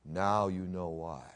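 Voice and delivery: very low, soft and slow; hushed reply. Retold or imitated. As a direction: let it drop quietly into the silence. Khrushchev's answer after a frightened silence.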